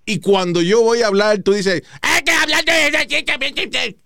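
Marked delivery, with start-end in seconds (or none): 2.0-4.0 high, slow mock of the cohost's voice; mocking imitation. Imitating his cohost cutting in, in a high slow whine. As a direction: drag it out in a whiny high tone.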